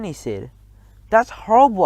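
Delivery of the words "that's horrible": an upset cry, higher and louder; cried out. A narrator's reading. Narrator crying out at Bruno, upset and loud. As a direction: protest in dismay. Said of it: "I cried".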